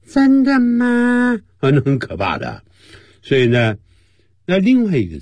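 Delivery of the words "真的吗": high, slow, coy "really?"; pretend Paul reply. Paul replying to the teacher's compliment, high and drawn out. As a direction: stretch it out high like a flattered student. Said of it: "那Paul就讲话".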